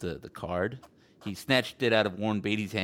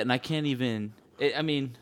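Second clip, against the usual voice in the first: ordinary talk, nothing marked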